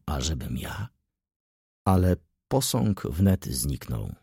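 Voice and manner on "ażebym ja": soft, low murmur; murmured to himself. A narrator's reading. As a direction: say it low, almost only to yourself. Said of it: "szepnął".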